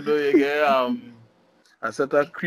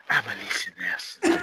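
lower, softer seductive radio voice; 9 semitones lower, 7 dB quieter than usual. Acted. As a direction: purr it like a sultry host. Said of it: "put on the kind of seductive".

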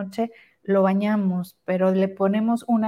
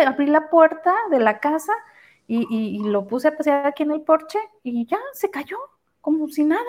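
retold surprised cousin; 6 semitones higher than usual. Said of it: "Y me dice".

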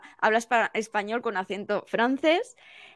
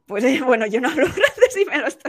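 laughing; 2 semitones higher, 4 dB louder, faster than usual. Real, not acted. Teacher admitting they don't speak French, laughing. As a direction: break up laughing mid-sentence.

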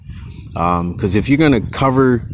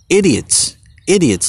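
higher alien voice sneering at humans; scornful alien; 8 semitones higher than usual. Acted. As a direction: look down from the spaceship and sneer. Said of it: "These guys in their spaceships looking down".

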